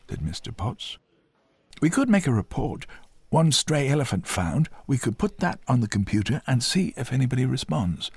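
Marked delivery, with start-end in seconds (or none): 1.8-8.1 higher-pitched desk sergeant, offering help; raised character voice for a policeman; helpful police sergeant. A narrator's reading. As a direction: voice an officious-but-helpful sergeant up high. Sergeant offers to file report.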